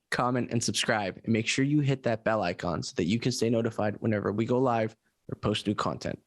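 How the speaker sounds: ordinary talk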